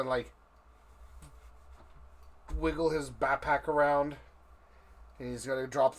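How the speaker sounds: normal talking voice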